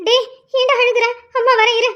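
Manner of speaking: put-on high, sing-song comfort to a child; soothing mother. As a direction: call out soothingly to your crying little one. Mother calls to crying child. (அழும் குழந்தையைச் சமாதானப்படுத்தும் உயர்ந்த குரல்)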